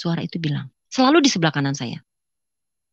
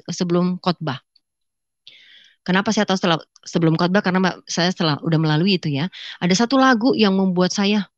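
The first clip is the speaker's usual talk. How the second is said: ordinary delivery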